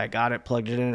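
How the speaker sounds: ordinary talk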